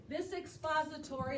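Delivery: usual speaking voice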